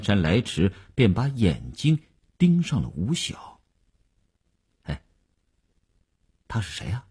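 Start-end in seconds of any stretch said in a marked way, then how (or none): 4.8-7.1 hushed, much softer aside; low-voiced question. A narrator's reading. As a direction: ask it under your breath. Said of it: "他第一句便这样低声地问". (压低声音悄悄地问)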